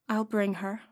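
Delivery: grim quiet promise; grimly. A narrator's reading. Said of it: "said Canute grimly".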